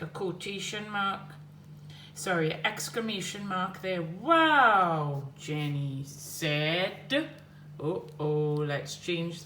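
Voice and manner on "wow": 1 exclaimed wow. Shown as a demonstration. Reading exclamation.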